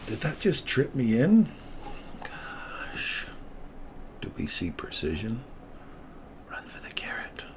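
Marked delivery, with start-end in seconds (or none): 2.2-7.4 soft, breathless whisper; hushed whisper. Real, not acted. Streamer whispering while the trade runs. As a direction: hold your breath and urge it on.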